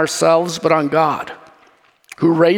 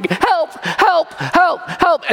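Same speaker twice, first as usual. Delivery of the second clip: mock yelling; 10 semitones higher, much slower than usual. Man yelling as his Jeep keeps rolling at him, high mock yell. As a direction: imitate a man yelling for help. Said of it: "then he starts yelling".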